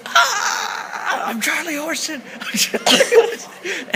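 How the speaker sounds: high, laughing fake pain cry; fake cramp cry. Captive fakes a leg cramp for the guard, high hammed-up cry. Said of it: "I'm gonna put on a charade".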